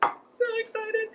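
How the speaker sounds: high, slow, sing-song squeal; excited squeal. Real, not acted. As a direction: gush it out in a high voice. Gushing while assembling cake.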